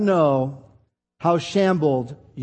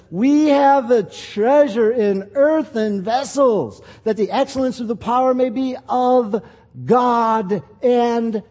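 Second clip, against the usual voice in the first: building sermon; 5 semitones higher, slower than usual. Acted. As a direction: preach it, building up the list. Rising preaching on earthen vessels.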